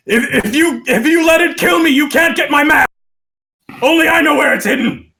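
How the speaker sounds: high, loud, fast stammered bargaining; desperate scream. Acted. Dwarf backed to the wall bargains for his life, screaming. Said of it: "basically screams out to you".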